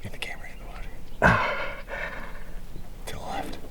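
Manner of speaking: soft unvoiced whisper. Real, not acted. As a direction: keep it hushed by the water. Whispering during fish release.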